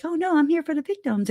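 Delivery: mock high-pitched fast sincerity, It's "Oh, no, I'm here for the victims". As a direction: parody their fake concern, high and quick. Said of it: "people who are like".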